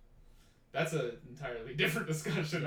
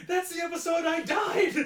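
high, loud panicked shout; mock panic attack; 8 dB louder than usual. Actor realises he died in that episode, mock panic shout. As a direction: shriek it like a hammed-up freak-out. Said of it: "like, a freaking panic attack".

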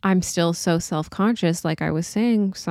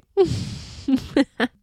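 short, higher-pitched laugh; self-conscious laugh; 6 semitones higher than usual. Real, not acted. Host admitting to few notes, higher self-conscious laugh. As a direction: laugh off your own lack of preparation.

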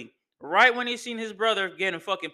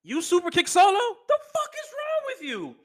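angry Roman; 11 semitones higher than usual. Retold or imitated. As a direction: chew him out in disbelief. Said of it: "Roman was like".

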